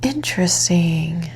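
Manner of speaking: slow, hushed near-whisper; breathy whispered aside, drawn out; murmured intrigue. Real, not acted. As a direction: murmur it slowly to yourself, pondering. Murmured reaction on revealing cards.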